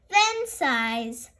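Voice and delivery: ordinary delivery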